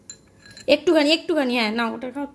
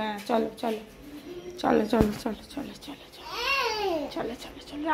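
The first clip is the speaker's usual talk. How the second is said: soothing hush; 9 dB quieter, faster than usual. Real, not acted. Coaxing toddler to sleep.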